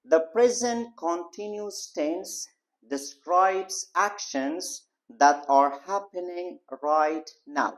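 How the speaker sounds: slow dictation. Shown as a demonstration. Teacher dictates English definition.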